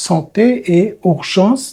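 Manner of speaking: santé et urgence stretched out very slowly; slow model reading. Shown as a demonstration. Teacher repeating the topic words slowly for the class. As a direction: draw out each word of santé et urgence.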